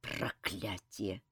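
low, slow, very soft curse; muted curse. A narrator's reading. Kantor straightens up with difficulty, cursing quietly. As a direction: curse under your breath with effort.

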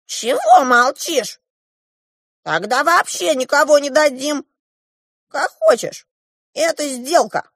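high, loud, pushy dwarf voice; impatient dwarf. A narrator's reading. The dwarf pushes the silent girl to decide, impatient. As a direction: badger in a high-pitched voice.

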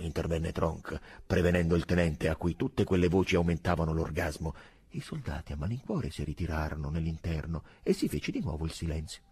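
ordinary delivery, nothing marked

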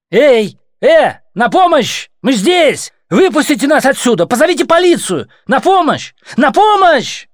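very high, loud yells for help; shouting for help. A narrator's reading. Trapped woman yells for help at the watchers, loud shouting. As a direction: scream it through the glass.

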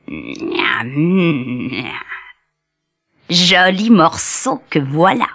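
low, soft, greedy wolf; wolf finds the dead cow, low and greedy; hungry wolf. A narrator's reading. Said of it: "fit le loup".

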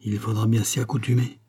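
ordinary delivery

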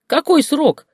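very high, loud furious question; angry demand. Acted. Grandmother screams at her daughter, very high.

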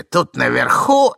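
put-on loud, drawn-out old man's voice. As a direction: stretch it out loudly between coughs. Old man droning between coughs.

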